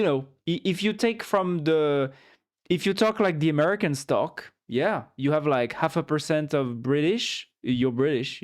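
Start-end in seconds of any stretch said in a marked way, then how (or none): none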